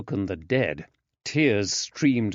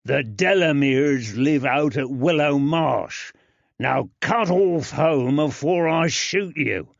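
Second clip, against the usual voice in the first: menacing jailer; 5 semitones higher, 4 dB louder than usual. Acted. Jailer threatens with pistol.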